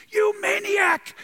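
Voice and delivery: very high, loud and slow yell; angry shout. Retold or imitated. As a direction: scream the insult at someone. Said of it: "if somebody shouts at you".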